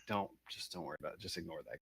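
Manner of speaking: low, soft, quick brush-off; quiet dismissive aside. Acted. Player telling Droop not to worry, quiet aside.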